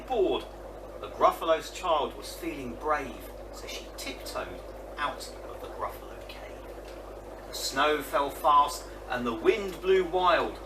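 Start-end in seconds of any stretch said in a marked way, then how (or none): none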